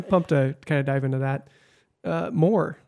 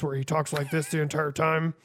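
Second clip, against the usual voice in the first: gruff, softer put-on voice; Steve Carell; 7 dB quieter than usual. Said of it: "Steve Carell's gruff voice".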